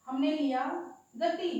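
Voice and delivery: ordinary delivery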